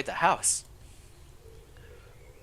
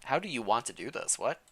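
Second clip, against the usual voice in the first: usual speaking voice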